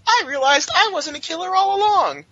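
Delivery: high, put-on innocent Gwen; sing-song fake innocence, mocking her. Host mocks Gwen claiming she was never a killer, high and prissy. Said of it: "It's very easy to say after the Jackal's dead".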